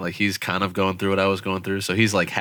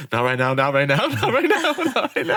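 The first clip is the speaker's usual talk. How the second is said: pleading through laughter, much higher; laughing plea; 8 semitones higher than usual. Retold or imitated. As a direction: beg while cracking up. Laughing while friend pretends punches.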